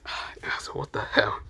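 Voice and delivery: hushed, breathy aside; whispered. Acted. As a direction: whisper it so nobody else hears. Barry Q reacts under his breath.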